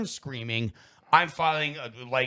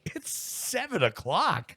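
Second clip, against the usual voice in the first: higher and slow, drawn out in disbelief; incredulous; 7 semitones higher, slower than usual. Retold or imitated. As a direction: stretch it out like it's absurd. Retold disbelief at complaint.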